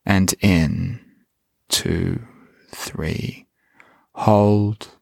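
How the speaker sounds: slow, calm meditation voice; guided meditation. Acted. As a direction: guide the listener gently inward.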